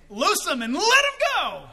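high order with a long held final go; ringing command. Acted. Preacher re-enacts Jesus's order his way, ringing command. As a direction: call it out jubilantly to free him. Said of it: "That's the way I see it".

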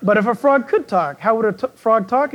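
ordinary talk, nothing marked

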